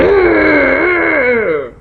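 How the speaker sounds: high, loud angry roar; cartoon anger growl. Shown as a demonstration. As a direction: roar like an angry little boy. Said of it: "his mouth is going".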